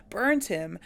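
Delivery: normal talking voice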